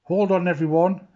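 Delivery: high, loud call to the crew; storybook call of excitement; cry out. A narrator's reading. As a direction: shout a warning to hold on. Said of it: "cried Jim".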